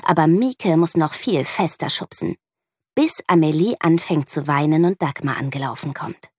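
ordinary delivery

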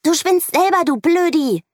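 put-on girl yelling back at the boy, high and loud; child yelling. A narrator's reading. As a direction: yell it at him. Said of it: "schreit Mieke".

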